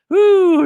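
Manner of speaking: a sudden high, loud whoop of excitement; excited whoop. Real, not acted. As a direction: let out a real hyped whoo. Hyped about breaking down game.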